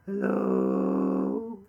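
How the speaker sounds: deep creaky vocal fry voice. Acted. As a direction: drop low into a creaky fry. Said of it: "What's happened to your voice?".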